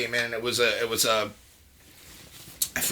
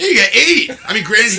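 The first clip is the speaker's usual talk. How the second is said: laughing; 9 semitones higher, 9 dB louder than usual. Real, not acted. Laughing at Hanukkah joke.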